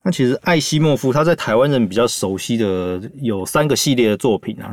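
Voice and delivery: usual speaking voice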